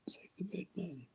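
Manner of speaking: soft, unvoiced chant; whispered slogan. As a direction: breathe the slogan secretly.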